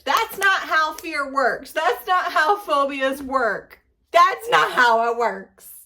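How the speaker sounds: slow and loud, each word hammered; emphatic rant. Real, not acted. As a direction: spell it out slowly, fed up. Ranting at the book's logic.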